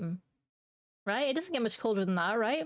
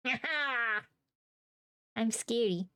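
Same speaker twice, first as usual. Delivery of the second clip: usual speaking voice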